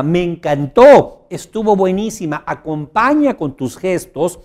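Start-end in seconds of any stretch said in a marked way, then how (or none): 0.0-2.4 emphatic enthusiastic yes. Shown as a demonstration. Coach showing an answer backed by nodding. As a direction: sell it with full conviction.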